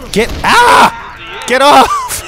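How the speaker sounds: high, loud scream to get off; real panicked shouting. Real, not acted. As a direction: yell while being grabbed. Shouting during attack.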